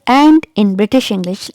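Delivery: ordinary delivery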